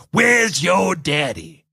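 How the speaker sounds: slow, drawn-out retold shout; mock taunt. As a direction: call it out slowly, as a wrestler's taunt. Retelling a wrestler's taunt.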